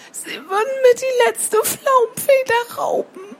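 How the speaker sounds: put-on high, tearful child wail; bitter sobbing. Baby sparrow fears the cow, high and sobbing. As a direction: sob it like a frightened little sparrow. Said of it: "schluchzte er gar bitterlich".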